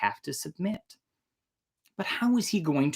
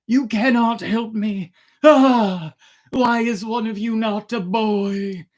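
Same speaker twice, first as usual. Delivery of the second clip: put-on despairing old emperor; 7 semitones higher, 4 dB louder than usual. A narrator's reading. Old emperor voiced in story.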